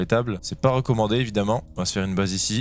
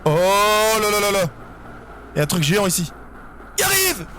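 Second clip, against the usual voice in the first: panicked yelling; 10 semitones higher than usual. Real, not acted. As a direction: wail as the giant comes. Giant monster approaches.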